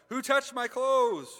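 high, loud, slow searching call; Jesus demanding who touched him, loud and slow; urgent call. Acted. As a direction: call out over the crowd who did it. Jesus calls out in crowd.

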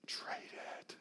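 breathy whisper, barely voiced; hushed urging whisper. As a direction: lean in and whisper the dare. Whispered aside in sermon.

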